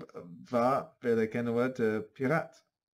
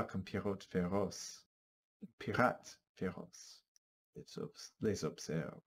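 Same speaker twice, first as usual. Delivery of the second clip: hushed; 3 semitones lower, 9 dB quieter, slower than usual. Acted. Suspenseful narration, hushed.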